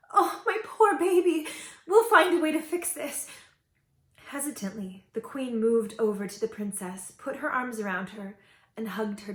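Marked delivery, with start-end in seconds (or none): 0.1-3.3 higher, tearful comforting; crying. A narrator's reading. Queen crying over her daughter. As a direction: weep while reassuring. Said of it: "The queen began to cry".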